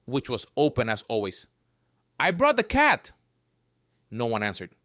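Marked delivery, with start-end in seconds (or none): 2.2-3.1 high, loud call. Acted. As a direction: call out to the house. Calling through window.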